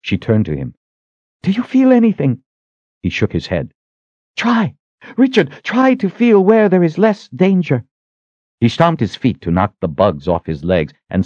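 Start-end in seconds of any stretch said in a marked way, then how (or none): 1.4-2.4 high-pitched, urgent asking; anxious question. A narrator's reading. Sister asking Richard if he senses the way, anxious. Desperate question in a dangerous place. 4.4-7.8 high-pitched, pressing entreaty; urgent plea. A narrator's reading. Sister begging Richard to find less danger, urgent plea.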